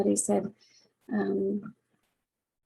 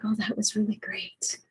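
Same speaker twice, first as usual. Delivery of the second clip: hushed, breathy whisper of mom's words; quoting mom in a soft whisper; whispered quote; 4 dB quieter than usual. Retold or imitated. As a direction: whisper it like a happy secret. Mom's words after visiting friends.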